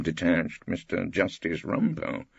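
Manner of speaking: ordinary delivery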